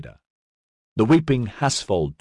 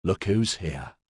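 growl; 5 dB quieter, slower than usual. A narrator's reading. Boyd to Edyard, a quiet growl. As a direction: mutter it under your breath. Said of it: "he growled".

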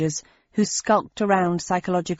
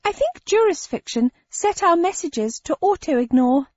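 much higher, odd creature voice; high shape-changer voice; 10 semitones higher than usual. Acted. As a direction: pitch it up as the shifter.

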